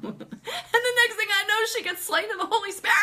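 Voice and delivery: high, squealed telling through laughter; laughing excitedly. Real, not acted. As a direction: burst out laughing as you tell it.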